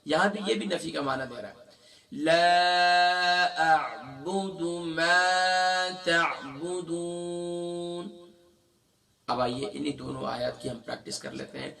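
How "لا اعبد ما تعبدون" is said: لا and ما both drawn long, very slow; stretched recitation. Shown as a demonstration. Teacher reciting with ما stretched. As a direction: hold each long vowel in recitation. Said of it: "ما کو بھی ہم نے کھینچنا ہے".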